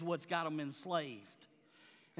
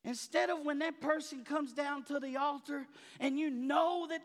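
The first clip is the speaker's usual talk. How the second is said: raised, ringing pulpit voice; fired-up preacher calling the church to care; full-force preaching; 6 semitones higher, 3 dB louder than usual. Acted. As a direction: preach it out to the congregation.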